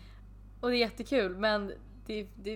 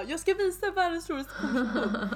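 laughing; 8 semitones higher than usual. Retold or imitated. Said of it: "jag sitter där och bara".

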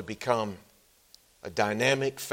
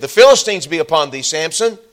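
louder, quick alarm call; Delilah's warning; 5 semitones higher, 12 dB louder than usual. Retold or imitated. Delilah waking Samson, loud warning. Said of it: "she said".

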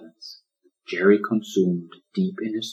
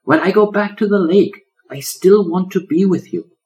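high, eager little turtle cry; bursting with joy; joyous exclaim; 11 semitones higher, 7 dB louder than usual. Acted. Turtle tells the lion he wants to stay, joyous and high.

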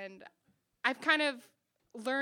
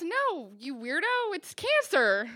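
imitated exasperated doctor, higher and louder; exasperated correction; 7 semitones higher, 8 dB louder, slower than usual. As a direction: correct them in loud mock exasperation.

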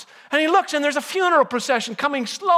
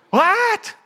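shocked what; 10 semitones higher than usual. Acted. Man seeing the funeral banner at his opening, shocked.

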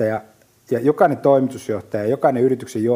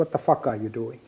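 ordinary talk

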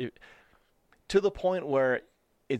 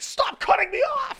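high mock scream at a driver; road rage scream; 5 dB louder, slower than usual. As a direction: shriek in pent-up fury. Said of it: "You're like".

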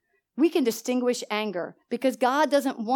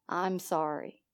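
low, soft retold apology; 5 semitones lower, 8 dB quieter, slower than usual. Mother apologising to her angry daughter, soft voice. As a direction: answer anger with a gentle, hushed apology. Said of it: "Make your voice soft".

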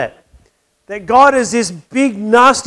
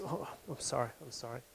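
much softer, lower mumbled sorry; quiet, lower sheepish sorry; sheepish apology; 8 semitones lower, 26 dB quieter than usual. Retold or imitated. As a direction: apologise under your breath. Golfer apologises again.

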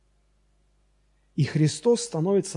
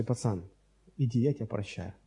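gentle forgiving; 5 semitones lower, 8 dB quieter than usual. Retold or imitated. Forgiving the boy softly.

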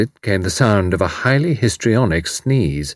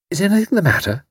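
higher, gently concerned question; concerned woman; 11 semitones higher, faster than usual. A narrator's reading. Tuppence asking upset young man, concerned. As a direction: ask carefully, caught off guard. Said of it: "paused involuntarily and asked".

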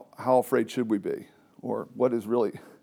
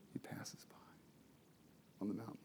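barely audible whisper; 3 semitones lower, 25 dB quieter, faster than usual. Acted. Teacher whispers how God passes by Elijah. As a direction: breathe it like the still small voice.

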